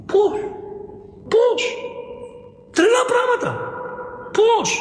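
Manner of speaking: incredulous cry. Real, not acted. Preacher reacting to the strange story, amazed cries. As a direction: exclaim in disbelief, high up.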